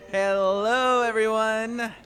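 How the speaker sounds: loud, high welcome to the crowd; excited greeting. Real, not acted.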